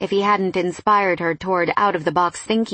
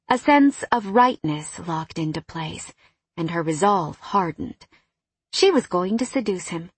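ordinary delivery, nothing marked

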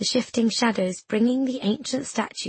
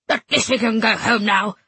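sniffy; 3 dB louder than usual. A narrator's reading. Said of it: "Missus B sniffed".

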